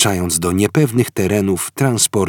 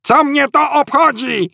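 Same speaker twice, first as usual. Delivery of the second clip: much higher, furious howl. Acted. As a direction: howl it in rage. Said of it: "zawył Ford wściekle".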